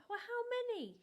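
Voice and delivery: mock-exasperated demand; exasperated mum. Mother pressing her son for the number, exasperated. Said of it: "she'd say".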